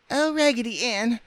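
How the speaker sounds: very high, squeaky appeal; French doll crying out. A narrator's reading. Doll appealing to her leader, crying out. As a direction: cry it out in falsetto. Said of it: "cried the French dolly".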